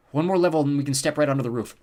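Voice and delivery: eager call to companions. A narrator's reading. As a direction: call it over to the others. Character calls out to siblings.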